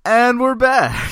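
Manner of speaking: very high, loud, slow host call; showman announcement. Acted. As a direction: stretch it out like a game show host. Mock show re-opening.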